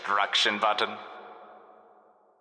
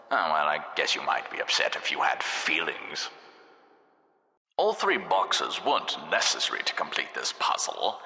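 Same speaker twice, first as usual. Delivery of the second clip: ordinary delivery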